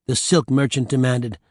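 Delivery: ordinary delivery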